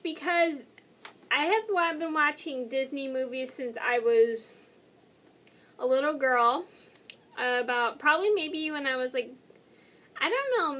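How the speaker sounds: ordinary talk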